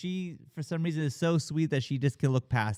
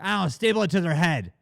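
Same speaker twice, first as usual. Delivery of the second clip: louder, fast careless brush-off; dismissive boss; 4 semitones higher, 5 dB louder, faster than usual. Retold or imitated. TV boss to crew guy, fast careless brush-off. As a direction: toss it off like a callous boss. Boss snaps in retold scene.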